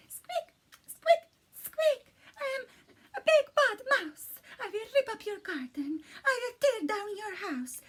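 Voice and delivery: very high squeaky mouse, big and loud. A narrator's reading. Narrator reads the mouse's threatening note in a high squeaky voice. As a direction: squeak it out like a tiny mouse playing tough.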